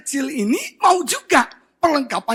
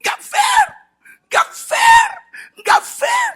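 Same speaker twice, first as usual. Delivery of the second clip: put-on high-pitched bawling child; mock sobbing kid protest; imitated crying; 4 dB louder, much slower than usual. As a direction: wail it like a little boy in tears. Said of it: "Dia nangis".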